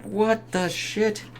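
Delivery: high, slow, stretched-out mock shock; drawn-out goofy disbelief; mock shocked face. Reviewer voicing the character's shocked face, high and slow. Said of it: "his face is like".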